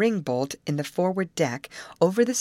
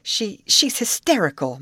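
ordinary delivery

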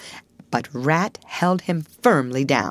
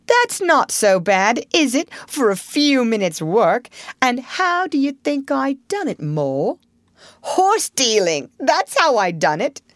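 boastful Toad; 8 semitones higher, 3 dB louder than usual. A narrator's reading. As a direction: gloat over the trick.

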